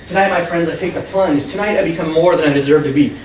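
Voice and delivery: plain reading voice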